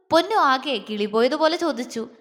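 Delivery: usual speaking voice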